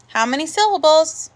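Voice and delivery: ordinary talk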